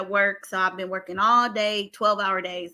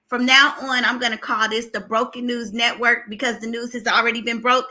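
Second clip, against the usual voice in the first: ordinary talk, nothing marked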